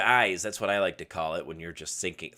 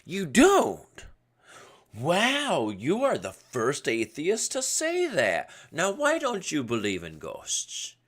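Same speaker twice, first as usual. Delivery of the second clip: higher, slow, astonished caricature; mock neighbor voice; 7 semitones higher, 3 dB louder, much slower than usual. Mocking neighbor amazed an atheist denies ghosts, high slow caricature. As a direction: stretch it out like an amazed busybody. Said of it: "Again, he doesn't talk like that".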